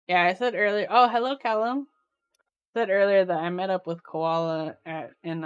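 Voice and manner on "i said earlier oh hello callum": ordinary talk, nothing marked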